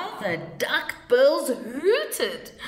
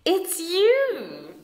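laughing; 10 semitones higher, 5 dB louder than usual. A narrator's reading. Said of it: "they laughed and said".

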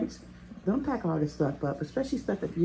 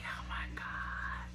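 overwhelmed whisper; 7 dB quieter, much slower than usual. Real, not acted. Mom overwhelmed, son leaving for college.

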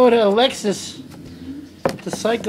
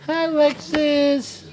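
slow cooing greeting; 6 semitones higher, slower than usual. Real, not acted.

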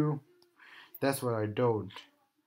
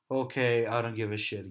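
ordinary delivery

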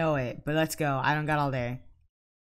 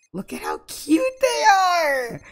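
gushing; 13 semitones higher, 4 dB louder, slower than usual. Real, not acted. Admiring couple.

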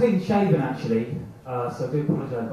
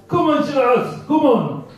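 loud, high comic motivator; Johnny Vegas; 7 semitones higher, 6 dB louder than usual. Acted. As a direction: shout encouragement like an overexcited trainer. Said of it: "Johnny Vegas".

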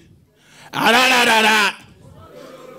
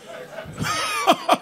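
pastor's high cackle. Retold or imitated. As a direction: cackle like a wild preacher. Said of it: "he can't laugh this laugh".

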